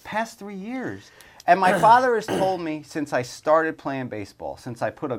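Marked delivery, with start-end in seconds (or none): 0.0-1.1 "Past three years" drawn out slowly, pitched high, stress on "past"; slow emphatic echo. Real, not acted. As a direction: stretch out "Past three years" and lean hard on "past".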